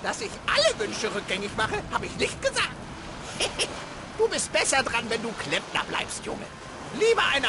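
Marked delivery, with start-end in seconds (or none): 2.7-4.1 sly snicker. Acted. Kobold snickers.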